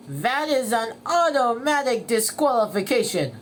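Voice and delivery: loud commentary rising to a punched call; race announcer. Acted. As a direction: announce the ruling to the crowd. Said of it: "said the announcer".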